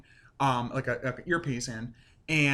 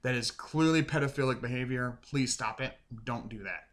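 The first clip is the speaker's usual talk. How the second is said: ordinary delivery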